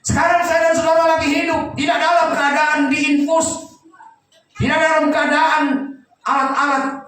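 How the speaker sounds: high, loud preaching; preaching at full force. Acted. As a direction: proclaim it to the whole church.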